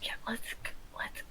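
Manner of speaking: barely voiced urging to leave; whisper. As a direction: whisper them out of hiding. Urging the party to sneak away.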